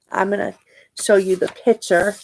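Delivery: usual speaking voice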